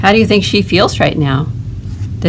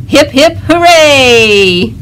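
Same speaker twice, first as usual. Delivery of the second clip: cheer; 7 semitones higher, 4 dB louder, much slower than usual. Acted. Duck cheering in story.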